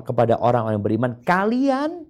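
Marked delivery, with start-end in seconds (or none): 1.3-2.1 high, stretched mock call; mocking disbelievers. Preacher voicing disbelievers calling believers, high call. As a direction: call out Kalian high and drawn out. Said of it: "Orang-orang kafir berkata".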